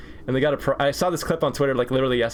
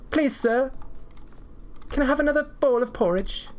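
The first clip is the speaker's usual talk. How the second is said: put-on high, small-boy British plea; British ten-year-old; 12 semitones higher than usual. To the cafeteria guy, a high British orphan plea. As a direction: beg sweetly like a Victorian orphan. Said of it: "talking like a British ten-year-old".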